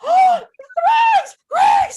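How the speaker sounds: high, loud frantic scream of the name. Retold or imitated. Boy yelling from the window to his dog, screaming. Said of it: "he screamed".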